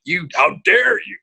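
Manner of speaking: retold how-dare-you, puffed up with triumph; victorious indignation. Singer retelling his outburst at a bandmate, victorious and indignant. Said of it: "and I felt victorious".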